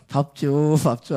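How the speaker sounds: drawn-out mock whine for food; crying hungry calves. Hungry calves begging their mother, mock crying. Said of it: "하고 울기 시작해요".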